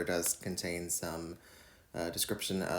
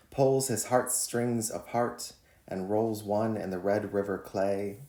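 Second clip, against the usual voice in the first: measured, deliberate poem reading; poetry reading; 5 dB louder than usual. Acted. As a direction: read each line like a spoken poem. Reads his poem On Creation.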